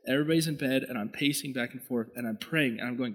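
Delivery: ordinary talk, nothing marked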